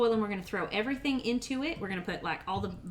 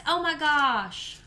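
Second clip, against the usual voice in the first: surprised; 5 semitones higher, 7 dB louder, much slower than usual. Real, not acted. Reacting to misread name.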